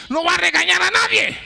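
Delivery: usual speaking voice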